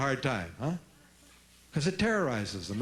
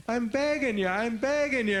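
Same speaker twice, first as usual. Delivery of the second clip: put-on begging kid; 7 semitones higher than usual. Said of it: "And she said".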